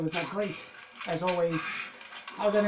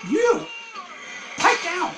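yelling at zombies; 9 semitones higher, much slower than usual. Real, not acted.

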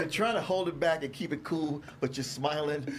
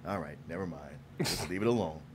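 quiet; 10 semitones lower, 7 dB quieter than usual. Retold or imitated.

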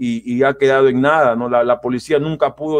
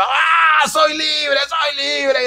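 much higher, ecstatic yelling of freedom; overjoyed cry of release; joyful shout; 12 semitones higher, much slower than usual. Retold or imitated. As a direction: shout it like you just got out of jail. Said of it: "y yo gritaba".

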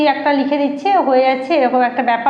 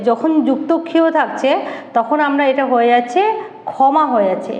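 ordinary talk

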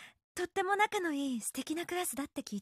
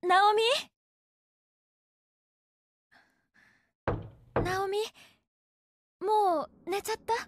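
raised voice through a door; calling out; 4 semitones higher, 3 dB louder than usual. Acted. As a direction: call to her daughter in the next room.

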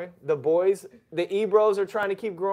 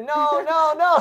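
mock outraged no; 10 semitones higher, 9 dB louder, slower than usual. Mock outrage at being refused.

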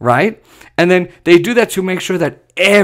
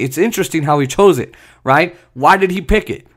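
ordinary talk, nothing marked

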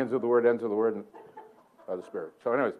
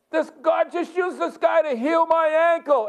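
high, slow shout to everyone, retold; hammed-up announcement; 3 dB louder, slower than usual. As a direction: proclaim it to the whole plane, hammed up. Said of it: "and then he goes".

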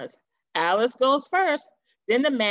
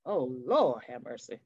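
shocked aside; 8 semitones lower, 5 dB quieter than usual. Acted.

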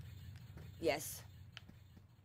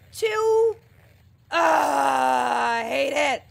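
angry outburst; 3 semitones higher, 6 dB louder, much slower than usual. Real, not acted. Raging at a game death.

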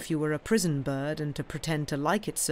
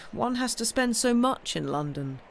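higher-pitched, mildly sheepish excuse; lame apology; 7 semitones higher than usual. A narrator's reading. Nephew excusing his spending to his uncle, lame apology. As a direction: offer a feeble excuse. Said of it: "murmured Sam in lame apology".

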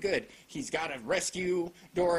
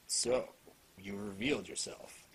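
stoic hero voice; 8 dB quieter than usual. Retold or imitated. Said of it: "He's like".

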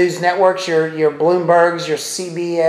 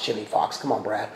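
very low, soft mutter to himself; quiet, low aside after a slip; muttered self-correction; 8 semitones lower, 12 dB quieter than usual. Real, not acted. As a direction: scold yourself under your breath. Scolds himself for misreading name.

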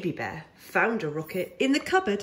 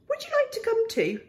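much higher, squeaky little bear asking; Baby Bear voice; 13 semitones higher, faster than usual. Acted. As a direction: ask like an eager tiny cub. Baby Bear invites the owl.

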